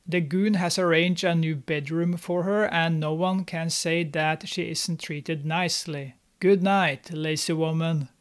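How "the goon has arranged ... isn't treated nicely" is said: ordinary talk, nothing marked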